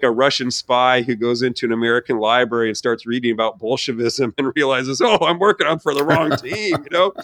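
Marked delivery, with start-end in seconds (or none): 5.0-6.8 higher, startled "oh" of a spy catching on; mock dawning shock. Russian spy realizes he's working for the wrong team, mock shock.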